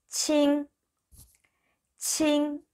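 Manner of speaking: 칭 stretched high and level; teacher's sustained 칭; model pronunciation. Shown as a demonstration. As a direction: hold 칭 ringing on an even high note. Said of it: "이렇게 발음하시면 됩니다".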